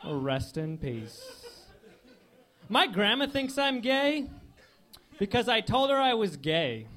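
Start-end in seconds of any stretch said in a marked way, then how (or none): 0.0-1.7 quiet, slow and laughing; soft slow murmur. Acted. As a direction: mutter the tribute softly. Quiet repeat of tribute.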